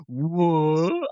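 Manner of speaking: a drawn-out rising growly "What?"; hammed-up dinosaur voice; raptor voice. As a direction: play the confused raptor turning round. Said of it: "and the raptor goes".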